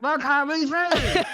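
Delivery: squeaky high, laughing disbelief; high-pitched mock shock, giggling. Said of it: "She was like".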